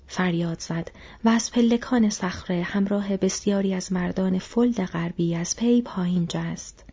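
plain reading voice

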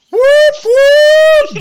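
loud high whoop-whoop like a train horn; train whistle imitation.

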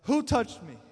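loud sudden call to the crowd; Jesus calls out who touched him; yelling out. Retold or imitated. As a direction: shout it over the crowd. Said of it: "he yells out".

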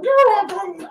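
mock high echo. Repeating Tim in a mock voice.

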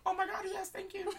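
very high squeaky thanks; hammed-up falsetto gratitude; mock delighted gushing. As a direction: squeal it like an overjoyed fan. Acting Grover's delighted thanks.

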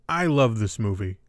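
ordinary talk

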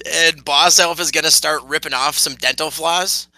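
ordinary delivery

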